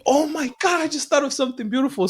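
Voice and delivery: real excitement, high and rushed; excited. Real, not acted. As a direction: blurt it out, thrilled by a new idea. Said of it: "it was that fucking good and exciting".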